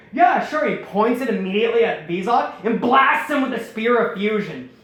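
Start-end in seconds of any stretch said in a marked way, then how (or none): 0.1-0.7 high, loud eager yes; eager agreement. Retold or imitated. Pirate eagerly agreeing before firing the spear. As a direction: blurt it out, way too keen. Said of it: "He's like".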